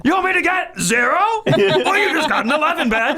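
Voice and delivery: very high, laughing mock fury; mock Louis rage. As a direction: crank it to eleven. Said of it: "He loses".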